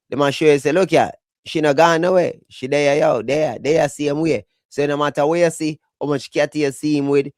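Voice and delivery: ordinary talk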